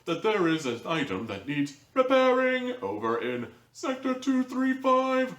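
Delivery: Omni Corporation notification. Pretend. Reviewer imagining the show's job alert, in a mock computer announcer voice. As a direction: play a hammed-up automated mission briefing. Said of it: "the Omni Corporation notification".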